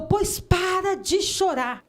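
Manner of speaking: high, slow, drawn-out order; retold authoritative command. Church brother ordering a crying woman to stop, authoritative. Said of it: "com autoridade".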